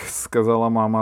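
ordinary delivery

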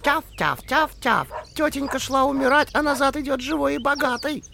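high yapping little dog voice; yappy panting puppy. Acted. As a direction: yap it out like a tiny dog. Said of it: "затявкала".

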